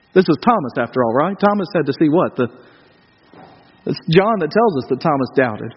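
usual speaking voice

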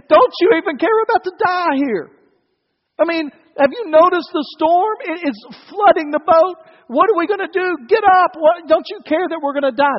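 high, loud, panicked pleading; urgent cry for help in a storm; alarmed disciples. Acted. Disciples to sleeping Jesus as the boat floods, alarmed.

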